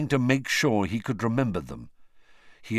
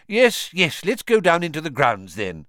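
louder, higher, hurried brush-off; impatient; 8 semitones higher, 5 dB louder than usual. A narrator's reading. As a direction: wave it away impatiently. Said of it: "said Cornelius impatiently".

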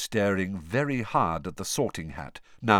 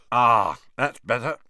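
talking through mashed potato; mouth full; 3 semitones higher, 7 dB louder than usual. Acted. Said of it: "with his mouth full of mashed potato".

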